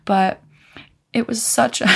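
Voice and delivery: normal talking voice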